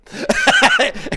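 loud high laugh bursting out; burst of laughter. Real, not acted. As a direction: crack up at the memory. Laughs at own daughter story.

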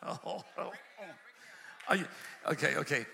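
normal talking voice

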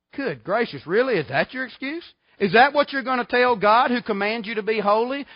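ordinary talk, nothing marked

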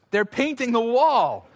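slow, high, laughing delight; thrilled reveal to the family in the car; excited announcement. Retold or imitated. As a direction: announce it like wonderful news, chuckling. Excitedly announcing the painted wall.

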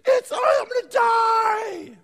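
very high, loud, slow mock child wail; preacher imitating a child howling over a scratch. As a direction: ham up a kid sure he's dying. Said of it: "they are screaming like their arm is cut off".

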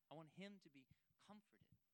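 normal talking voice

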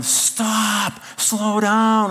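high, drawn-out mock yell; wife's high-pitched retold shout; imitated yelling. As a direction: yell for the boat to stop. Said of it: "And Kristen's yelling".

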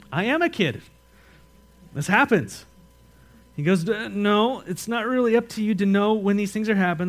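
loud, high, driving sermon voice; a preacher in full flight, loud and high; full-force preaching. Acted. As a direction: preach it out to the whole room.

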